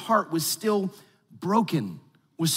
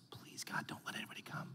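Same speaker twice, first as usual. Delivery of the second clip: hushed whisper, barely voiced; imitating a pastor's whispered prayer; 23 dB quieter than usual. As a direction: whisper it like a secret plea. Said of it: "Pastor Kevin goes".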